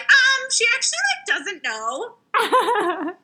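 high, slow, drawn-out mock voice; snarky mock critic. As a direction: sneer it like a smug commenter. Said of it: "have people jump on you and be like".

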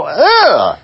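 high, drawn-out cry of dismay; hammed-up high "ugh"; mock dismayed groan. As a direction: groan like an appalled dog lover. Imitates upset dog lovers' reaction.

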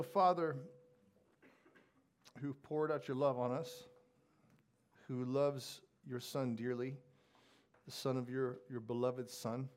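soft, hushed prayer voice. Acted. Pastor leading the congregation in prayer, hushed and reverent. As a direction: pray it quietly and reverently. Opening prayer before the sermon.

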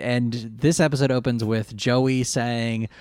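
normal talking voice

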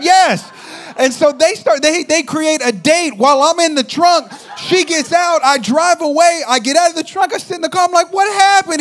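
high-pitched, shouted tirade; outraged rant. Retold or imitated. Guy recounts friend's date made while he's trunked, outraged. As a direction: yell the betrayal out in disbelief.

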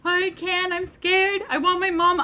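put-on high small-kid voice, a touch frightened; imitating scared child. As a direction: squeak it out like a scared little boy. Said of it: "he's like".

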